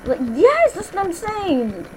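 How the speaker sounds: excited agreement. Real, not acted. Agreeing about uncut pizza.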